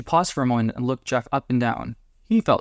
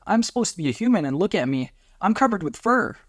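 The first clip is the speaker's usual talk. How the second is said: much higher, panicky complaint; distressed; 7 semitones higher than usual. A narrator's reading. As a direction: protest in horrified disbelief. Partner horrified by his change.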